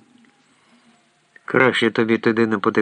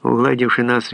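plain reading voice

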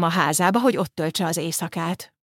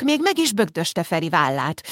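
plain reading voice

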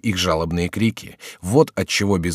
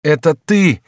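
high, louder cry of delight; delighted exclamation; 7 semitones higher, 6 dB louder, slower than usual. A narrator's reading. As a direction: exclaim it with joyful recognition. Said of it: "вскрикнул Генрих с восторгом". (восторженный вскрик)

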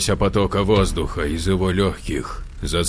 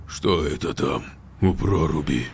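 low, softer hoarse rasp; 6 semitones lower, 7 dB quieter than usual. Acted. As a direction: rasp it out in shock. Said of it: "прохрипел Дмитрий".

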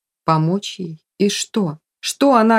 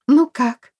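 bright question at a higher pitch; joyful; 7 semitones higher than usual. A narrator's reading. Sofia asks friends about her act, joyful. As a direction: ask eagerly, pleased with yourself. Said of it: "радостно спросила она".

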